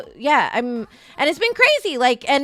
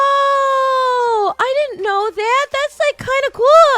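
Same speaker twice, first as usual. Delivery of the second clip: high, loud gasp then an excited cry; gasping excitement; 11 semitones higher, 4 dB louder, slower than usual. Retold or imitated. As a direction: act out the thrilled discovery. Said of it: "And I was like".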